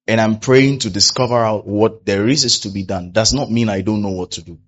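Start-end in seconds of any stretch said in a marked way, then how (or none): none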